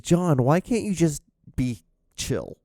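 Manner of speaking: high, slow exasperated plea; self-scolding. Acted. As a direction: plead with yourself in exasperation. Scolds himself in retold story.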